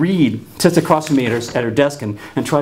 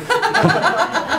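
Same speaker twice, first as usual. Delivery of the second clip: sudden high giggle; quick high laugh. Real, not acted. Speaker laughs at himself.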